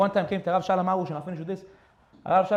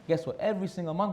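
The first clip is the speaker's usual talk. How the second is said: usual speaking voice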